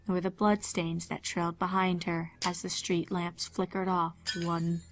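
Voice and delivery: ordinary talk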